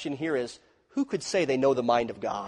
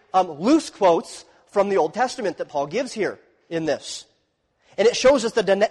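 normal talking voice

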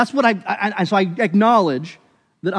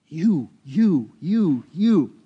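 each you punched separately; a preacher counting off each you; emphatic counting; much slower than usual. Shown as a demonstration. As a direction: hammer every you, one by one. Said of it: "How many times does God say "you".

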